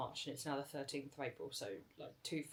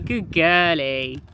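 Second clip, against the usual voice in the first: talking to a dog; 23 dB louder, much slower than usual. Real, not acted. Praising the dog, repeated.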